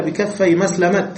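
مسلمة said as meslemat, stopping on a t; pausal taa. Shown as a demonstration. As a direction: end مسلمة with a sharp t sound. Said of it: "فوقف بالتاء فقال". (الوقف بالتاء: مسلمت)

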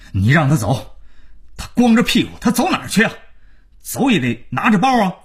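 high, heated outburst; still fuming. A narrator's reading. Father still raging as son tries leaving, fuming. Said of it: "大廖还气不过".

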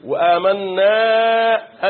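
وآمنا very slow, final aa held long and steady; long final alif. Shown as a demonstration. Teacher demonstrates the alif from the cavity, long final alif. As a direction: stretch the last alif of وآمنا. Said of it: "ألف من الجوف".

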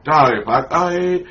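normal talking voice

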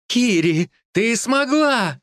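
high, slow joyful cry; overjoyed. Acted. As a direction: call out in delight. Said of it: "обрадовалась волчица".

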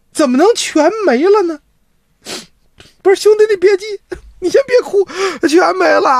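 high, anxious questions from the sister; a frightened sister pleading for answers; alarmed sister. Acted.

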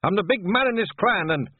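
high, loud Scots boast; yelling. A narrator's reading. Chief asserting himself over clansman, angry yell. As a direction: assert your rank at full voice. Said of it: "yelled Rob Anybody".

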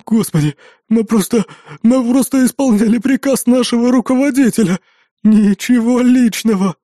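high, quavering excuses; trembling babble. A narrator's reading. Rescued twin excuses himself after the fall, trembling. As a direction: babble excuses, shaken and whiny. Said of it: "дрожа бормотал Диего".

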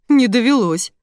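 high, lightly amused reply. A narrator's reading. Customer enjoying the man's odd challenge, amused. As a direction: answer with amusement. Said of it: "развеселилась я".

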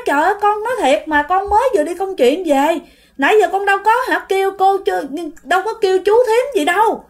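high-pitched pleading protest; insistent denial. Retold or imitated. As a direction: plead innocence as the accused neighbour. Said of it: "cái chị này cứ chối".

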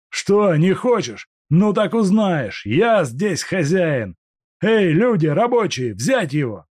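furious threat. Acted. Куликов продолжает ссору.

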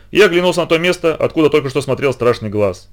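usual speaking voice

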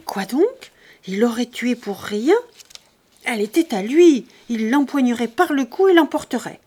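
worked-up indignant protest; anger. A narrator's reading. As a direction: shaken by anger, loud and insistent. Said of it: "Une colère le secoua".

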